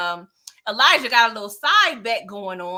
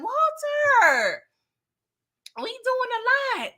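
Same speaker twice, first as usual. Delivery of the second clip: high, drawn-out exasperation; a high, stretched-out tsk at Walter on screen; exasperated scold; 12 semitones higher, slower than usual. Real, not acted. Host scolds character.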